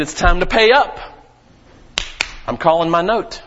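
the king demanding. Acted. Pastor voicing the king who calls in the debt, commanding. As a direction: call in the debt as a stern king. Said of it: "The king said".

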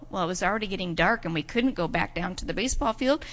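ordinary delivery, nothing marked

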